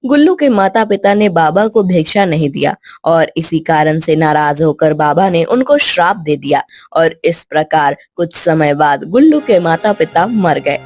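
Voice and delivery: ordinary delivery, nothing marked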